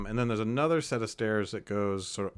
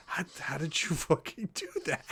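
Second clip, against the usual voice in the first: hushed, awed whisper with a laugh; breathless disbelief; amazed whisper; 5 semitones higher, 8 dB quieter than usual. Acted. Stunned NPC whispers to the hero who tamed the tiger.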